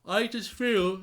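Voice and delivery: words stretched by a real yawn; yawning mid-sentence, worn out from the match. Real, not acted. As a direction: talk through a yawn, tired. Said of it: "sorry, I'm yawning".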